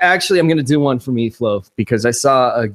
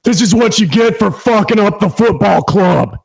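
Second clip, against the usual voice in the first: higher, put-on character voice; pitched-up comic impersonation; put-on gif caption voice; 4 semitones higher than usual. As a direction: act out the gif in a big character voice. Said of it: "accompany [name]'s gif by doing a, a little".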